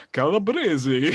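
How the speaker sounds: exaggerated mock-Italian pronunciation; hammed-up fake Italian lilt; cod Italian accent. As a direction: mimic an actor overdoing an Italian accent. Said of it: "like, a cod accent".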